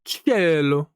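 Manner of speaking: Cielo repeated as Italian "chelo"; echoing Italian word. Shown as a demonstration. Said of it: "I wanna listen to that".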